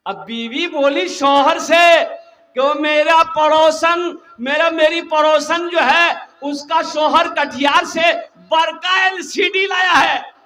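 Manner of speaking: loud, high shouted sermon, slow at the end; fiery preaching. Acted. Preacher urging young men to marry, fiery and shouted. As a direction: thunder it at the crowd.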